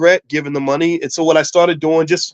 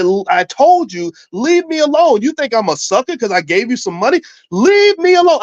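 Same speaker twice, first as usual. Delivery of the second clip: high, raised-voice outburst, acted out; retold yell; 9 semitones higher than usual. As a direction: reenact yelling in someone's face. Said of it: "And I yelled at him".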